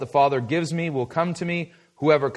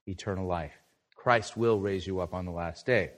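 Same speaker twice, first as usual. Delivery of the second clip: soft reassuring preaching; 7 semitones lower, 10 dB quieter than usual. Acted. Preacher softly reassures his listeners that eternal life is theirs. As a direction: drop to a much softer, intimate voice. Quiet assurance to the congregation.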